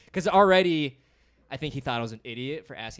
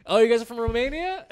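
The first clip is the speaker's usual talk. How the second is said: high, loud crowd-work question; projected stage voice, mock crowd work; stand-up comic; 9 semitones higher, 8 dB louder than usual. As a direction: play the comic addressing the audience. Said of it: "Should we, like, role play?".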